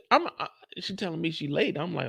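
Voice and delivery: usual speaking voice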